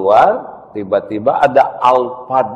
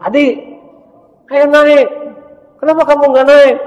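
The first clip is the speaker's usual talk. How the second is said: put-on much higher, louder woman calling a child; high teacher voice; 10 dB louder than usual. Teacher calls the boy onto the bus, high and loud.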